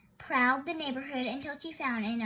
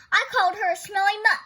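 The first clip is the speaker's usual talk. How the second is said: loud, fast and higher defiance; defiant; 7 semitones higher, 11 dB louder, faster than usual. Acted. Thorndyke defiantly repeating the insult to Nelson, loud and fast. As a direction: fling the insult back.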